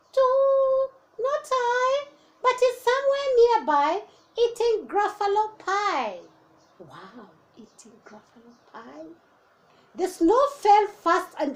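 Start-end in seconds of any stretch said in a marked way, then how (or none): 0.1-6.2 high, loud hooting owl; the owl denying it to the child. Acted. 6.8-9.1 hushed aside. Real, not acted. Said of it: "aside to children".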